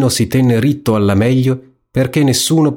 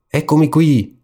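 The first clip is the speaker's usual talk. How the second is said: shout; 7 semitones higher than usual. Pretend. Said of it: "avesse gridato".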